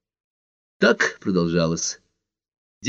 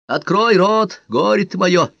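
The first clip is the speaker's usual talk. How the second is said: high-pitched exasperated mother; higher-pitched bossy mummy voice; scolding mother; 11 semitones higher, 3 dB louder, slower than usual. Acted. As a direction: play a nagging mum ordering her child to eat. Mother spoon-feeding, acted by officer.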